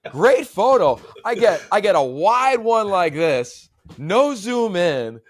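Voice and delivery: high, loud, slow mock outrage; building the joke, drawn out and loud; exasperated laughing rant. Real, not acted. Mocking the wide photo.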